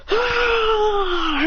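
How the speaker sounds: high crying ah; high anime effort cry; high strained yell. Acted. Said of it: "He goes".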